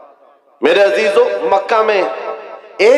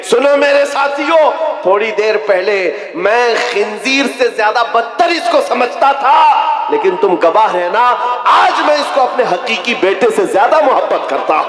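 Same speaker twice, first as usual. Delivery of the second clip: fiery preaching; 7 semitones higher than usual. Acted. Preaching voiced Umar declaration.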